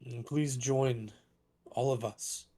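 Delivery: measured proclamation with long pauses; lightly voiced dragonborn orator, halting and grave; solemn public address. Dragonborn councillor addressing mourners at a vigil, solemn proclamation. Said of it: "very loud, probably magically amplified voice".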